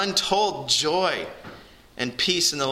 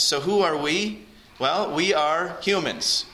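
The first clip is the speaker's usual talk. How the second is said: raised, ringing sermon voice, pressing the point; fervent preaching; 4 semitones higher than usual. Acted. Pastor calling listeners to Jesus, raised fervent preaching. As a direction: pastor urging the congregation to come to God, preaching fervently.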